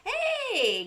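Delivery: high, loud, bright-eyed greeting call; excited hey. Real, not acted. Calling hey to viewer Andy in chat, excited. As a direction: sing out the hey to a friend.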